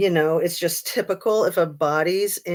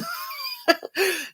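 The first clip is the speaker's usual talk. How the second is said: giggle; 13 semitones higher, 4 dB quieter than usual. Real, not acted. Laughs about sarcasm causing fights.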